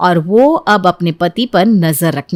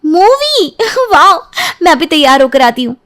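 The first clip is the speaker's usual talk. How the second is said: high, delighted squeal with a laugh; excited wife hearing about a movie date; thrilled squeal; 8 semitones higher than usual. Acted. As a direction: gush like a giddy bride.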